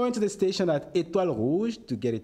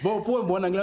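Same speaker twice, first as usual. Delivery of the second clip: laughing; 7 semitones higher, 6 dB louder, faster than usual. Real, not acted. Laughs after correction.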